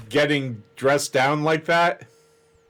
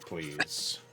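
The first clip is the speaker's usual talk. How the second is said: usual speaking voice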